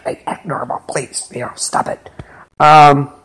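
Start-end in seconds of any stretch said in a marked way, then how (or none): 0.0-2.1 urgent hushed whisper, nearly no voice. Retold or imitated. Mary urging her sister to act normal, whispered. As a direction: hiss it under your breath so no one hears.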